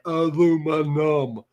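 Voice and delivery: aluminum drawn out syllable by syllable, a-LOO-mi-num; slow aluminum. Shown as a demonstration. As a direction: spell aluminum out slowly as if to someone deaf. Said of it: "Like you're deaf".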